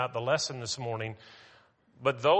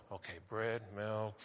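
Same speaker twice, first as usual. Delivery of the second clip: much softer, lower mutter to himself; muttering under breath; 3 semitones lower, 10 dB quieter than usual. Retold or imitated. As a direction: mutter the list quietly to yourself. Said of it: "just kind of muttering under my breath".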